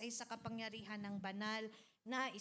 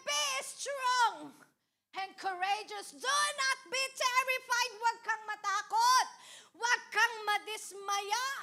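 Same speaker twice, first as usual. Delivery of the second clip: fiery pulpit preaching; preaching at full force; 10 semitones higher, 13 dB louder than usual. Acted. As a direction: proclaim the verse to the congregation. Preacher repeats verse forcefully.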